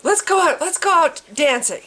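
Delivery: high, loud, overjoyed gushing; gushing relieved man. Retold or imitated. Gunman hugging the speaker, gushing loud and high. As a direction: gush like a man suddenly loved. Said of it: "He's like".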